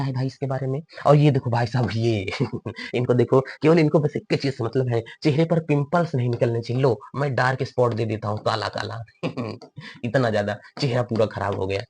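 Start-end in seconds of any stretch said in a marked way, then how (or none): 2.3-2.9 short, quiet laugh; soft chuckle. Real, not acted. Teacher amused by the picture. 9.1-9.5 brief, quiet laugh; small amused chuckle; soft chuckle. Real, not acted. Teacher amused at his own joke.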